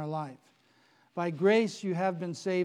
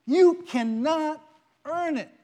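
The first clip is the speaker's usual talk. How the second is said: high loud slow cannot; slow, high on You cannot; emphatic point; 8 semitones higher, 8 dB louder, slower than usual. Real, not acted. Preacher tells the congregation salvation cannot be earned, emphatic.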